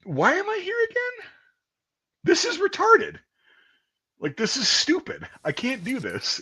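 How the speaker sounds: high, exasperated complaint fading out; despairing whine. Retold or imitated. Recruit regretting joining, high despairing whine. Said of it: "And it's like".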